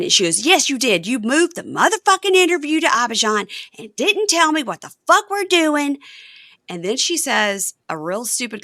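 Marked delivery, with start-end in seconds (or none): 0.5-6.0 high, loud cursing accusation; imitated fury. Wife accusing husband of moving interview, mock furious. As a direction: act out a furious wife laying into him.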